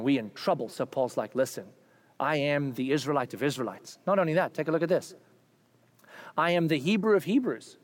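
normal talking voice

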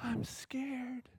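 scared whisper. Retold or imitated. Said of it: "she goes".

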